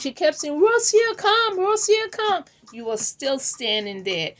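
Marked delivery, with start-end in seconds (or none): 0.6-2.4 higher, calling a name across the yard; mock mother beckoning someone over; lightly voiced mom calling Rosia to come; imitating mom calling out.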